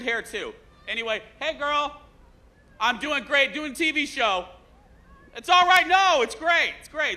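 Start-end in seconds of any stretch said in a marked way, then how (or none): 1.4-7.1 high, raised call to a passer-by. Real, not acted. Host hollers to a passer-by during live TV, calling out. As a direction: pitch it up high and call across the street.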